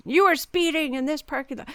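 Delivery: mock-stern complaint; mimicked scolding. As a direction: imitate a neighbor reprimanding a speeder. Retold scolding from an angry neighbor.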